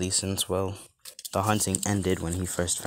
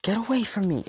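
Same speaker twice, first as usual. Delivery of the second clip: high-pitched yelped protest, not much louder; mock yell; 4 dB louder than usual. A narrator's reading. Flustered Raven to oblivious Deku, high-pitched yell. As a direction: cry out in a high, restrained yell. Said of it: "she yells out".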